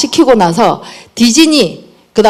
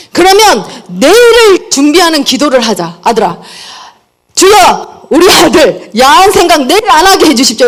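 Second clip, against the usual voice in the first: mock earnest prayer; 5 semitones higher than usual. Mother leads son's nightly prayer.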